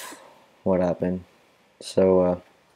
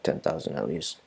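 ordinary talk

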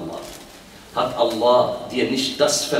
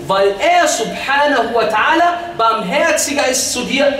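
forceful preaching; 9 semitones higher, 4 dB louder than usual. Real, not acted. Preacher pleading against self-harm through scripture, forceful. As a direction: exhort them with raised voice. Preaches, then recites verse.

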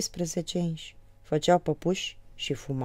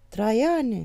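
calling out louder and higher; shout; 6 semitones higher, 5 dB louder than usual. Retold or imitated. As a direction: yell his name across the room. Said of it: "strig eu". (strigăt după Traian)

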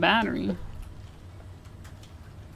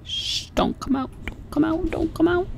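hushed pleading, rising to a plea; pleading whisper; 7 semitones higher than usual. Real, not acted. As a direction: beg it not to come. Begging monster not to find them.

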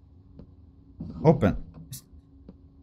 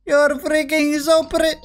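flirty high voice; 8 semitones higher than usual. Acted. Flattering the shopkeeper.

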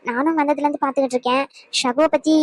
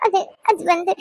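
tearful hesitation; 4 semitones higher than usual. Acted. Crying woman evades the question.

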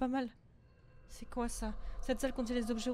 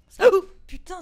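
much higher and much louder burst; startled scream; 9 semitones higher, 21 dB louder than usual. Real, not acted. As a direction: shriek at a sudden scare. Jump scare from bulb.

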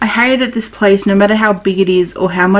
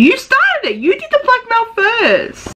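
high indignant retort; slower than usual. Acted. Character retorts in blackmail argument.

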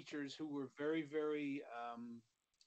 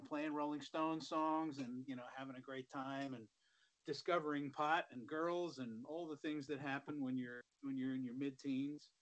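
normal talking voice

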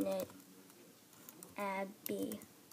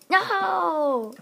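dismayed shout; 6 semitones higher, 5 dB louder than usual. Real, not acted. Being killed in game.

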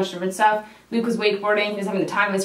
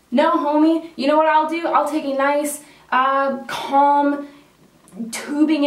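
sassy refusal; 6 semitones higher, 3 dB louder, slower than usual. Retold or imitated. Her retorted refusal to friends.

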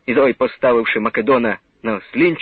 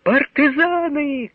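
high, slow joyful calling out; joyful shout; 11 semitones higher, much slower than usual. A narrator's reading. Someone in the crowd spotting partisans, joyful high call. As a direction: hail the partisans with joy. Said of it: "крикнув хтось радісно".

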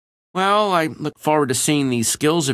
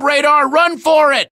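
shout; 8 dB louder than usual. Acted. Urging Radar to flee.

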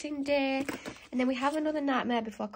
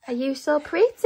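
high cooing pet voice; 4 semitones higher, 9 dB louder than usual. Real, not acted. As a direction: coo it to the puppy. Cooing at the dog.

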